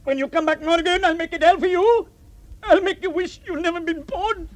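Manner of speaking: high, loud furious threat; angry threat. Acted. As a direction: swear revenge at full voice. Threatening Morgan.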